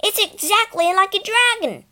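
put-on high, loud child's shout over the train; shouting over noise. Boy shouting to his sisters over the train noise, excited. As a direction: call out above the roar. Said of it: "Peter shouted above the noise".

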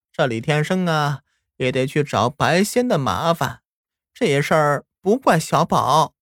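put-on slow, high, gentle old grandma; calm everyone down, slowly; soothing grandma.

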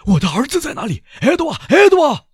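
loud, very high panicked calling; building to a full scream; frantic shout. A narrator's reading. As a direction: shout desperately for your missing son. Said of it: "他突然嚷道".

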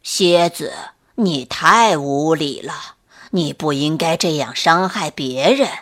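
put-on old turtle. A narrator's reading. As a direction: gently scold like an old turtle. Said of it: "老乌龟慢悠悠地爬过来说".

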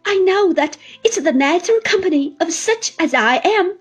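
high, loud despairing lament. Acted. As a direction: pour out hopeless grief. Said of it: "the tone of those words".

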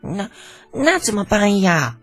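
high, drawn-out worried plea; high-pitched boyish dismay, slowly stretched; anxious. A narrator's reading. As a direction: fret like a young prince at a loss. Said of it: "李承前急了".

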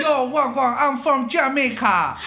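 put-on high, slow, laughing Jamaican accent. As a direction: ham up a Jamaican greeting. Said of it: "牙买加话".

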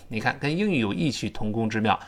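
ordinary talk

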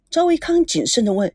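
ordinary delivery

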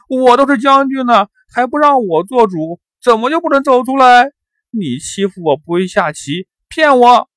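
put-on loud, high childish wail; acted tantrum, crying out each accusation; bawling protest. A narrator's reading. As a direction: wail like a thwarted, spoiled boy. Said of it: "大哭起来".

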